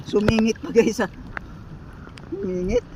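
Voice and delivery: thrilled, voice jumping up; excited. Real, not acted. Fish slipped into the net.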